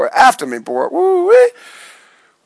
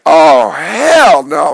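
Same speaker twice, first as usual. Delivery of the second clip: slow drawn-out dismay; 3 semitones lower, 5 dB louder, much slower than usual. Retold or imitated. Hearing police cars in dream.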